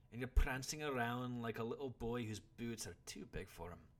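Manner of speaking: loud, high exasperated scolding; old warrior berating his pupil; angry disgust. A narrator's reading. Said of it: "been disgust or anger at the boy's words".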